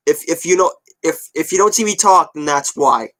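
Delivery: ordinary delivery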